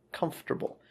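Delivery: comfortable said softly as KUMF-ter-bul; soft repeat of "comfortable" with FT before R; model pronunciation. Shown as a demonstration. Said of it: "it's pronounced F T R".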